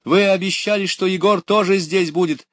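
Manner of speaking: put-on high, loud child's protest; crying out in protest. A narrator's reading. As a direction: protest loudly, pitch way up. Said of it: "закричала Люська". (громкий высокий крик протеста)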